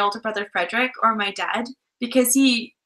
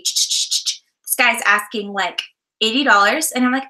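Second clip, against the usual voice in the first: ordinary talk, nothing marked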